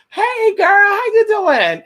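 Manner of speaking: very high, drawn-out sugary greeting. Retold or imitated. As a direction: stretch it high and sweet like a fake-friendly stranger. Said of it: "they're gonna say".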